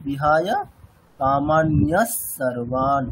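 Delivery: "কামান্যা সর্বান" with a long hissing s between the words; very slow chant, lean on the joined s; visarga as dental s. Shown as a demonstration. Teacher demonstrating the dental s sandhi slowly. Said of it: "দন্ত্যেস হিসাবে change করে দিচ্ছি".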